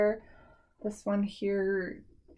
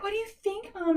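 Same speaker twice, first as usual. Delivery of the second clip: put-on high, soft kid voice asking for approval; high hopeful teen daughter voice; imitating daughter; 12 semitones higher, 3 dB quieter than usual. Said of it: "she's like".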